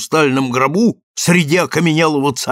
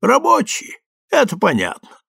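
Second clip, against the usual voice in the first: high-pitched, mildly apologetic explanation; 8 semitones higher than usual. A narrator's reading. Guest explains the workers' cursing, apologetically. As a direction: offer the excuse lightly, pitched up. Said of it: "как бы извиняясь за выражение стены".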